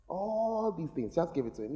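slow, each word weighed; slow drawn-out repeat. Shown as a demonstration. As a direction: drag it out for weight.

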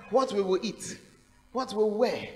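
ordinary delivery

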